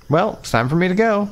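high, lightly mimicking her parting line; mimicking Lena. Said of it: "something about that was a bit off".

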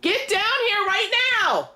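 high, exasperated yell; frustrated shout upstairs; yelling across the room. Real, not acted. Frustrated calling up stairs.

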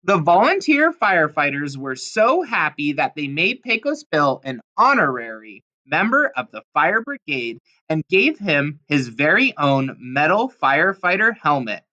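usual speaking voice

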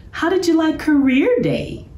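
high, friendly professor asking; an eager teacher quizzing the cubs. A narrator's reading. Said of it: "asked the professor".

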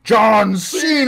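booming drawn-out meme yell; announcer shout. Pretend. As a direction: bellow the name like a ring announcer. Meme shout while revealing himself.

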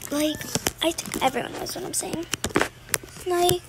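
normal talking voice

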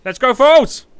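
high, loud rallying cry; rally the viewers with a big yell; hype shout. Real, not acted. Hyping up chat.

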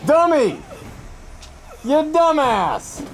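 high-pitched exasperated scolding; self-berating outburst. Real, not acted. Berates self after failed attack.

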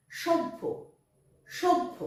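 সভ্য said as "shobbho", with a doubled bh; high, careful সভ্য with the bh held double; clear pronunciation demo. Shown as a demonstration. Teacher demoing the word to pupils, careful and clear. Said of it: "ভয় জফলা".

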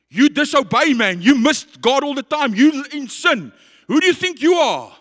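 high, loud berating; shouted, scornful condemnation; accusing shout. Acted. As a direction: hurl accusations as the voice of guilt. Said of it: "guilt and that shame is shouting at you".

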